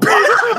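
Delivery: sudden high, loud laugh; real laugh bursting out in disbelief; burst of laughter. Real, not acted. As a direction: crack up at the number. Laughing at the ninety-two score.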